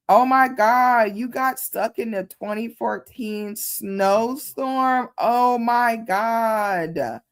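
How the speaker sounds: high, slow drawn-out oh my God; shocked reaction. Real, not acted. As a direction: gasp at the news, dragging it. Reacting to a chat comment.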